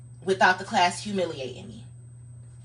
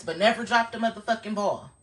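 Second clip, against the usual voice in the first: normal talking voice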